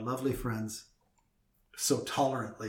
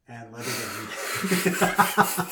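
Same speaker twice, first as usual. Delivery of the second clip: very slow, cracking up mid-cue; laughing through it; 5 dB louder, much slower than usual. Real, not acted. Host teasing cohost with endless breaths, laughing. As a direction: try to finish the cue but collapse into laughs.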